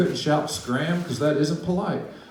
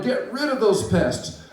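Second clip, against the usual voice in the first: mock shout; 8 semitones higher than usual. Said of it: "All Thidwick's friends shouted".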